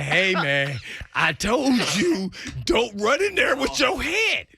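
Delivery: high, slow scolding call; mock scolding shout. Teammate telling off the injured friend, high and slow. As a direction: scold him high and drawn-out.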